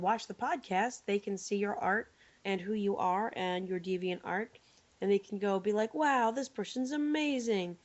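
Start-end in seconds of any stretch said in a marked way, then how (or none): none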